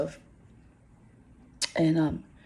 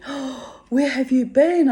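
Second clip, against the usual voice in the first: higher, gushing welcome; imitating thrilled staff; 7 semitones higher, 5 dB louder, slower than usual. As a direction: play the delighted security guards greeting her. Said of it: "they all said".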